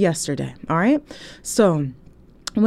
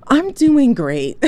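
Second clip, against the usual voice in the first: drawn-out sarcastic reply; 5 semitones higher, 4 dB louder, much slower than usual. Retold or imitated. Mocking reply, slowed.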